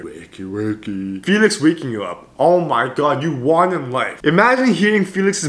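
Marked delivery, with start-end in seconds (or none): 0.0-1.2 slow, soft, lightly voiced wakey wakey; imitated wake-up whisper. Imagined husband waking his sleeping wife, slow and soft. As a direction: murmur it slowly like waking a wife. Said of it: "and then you hear".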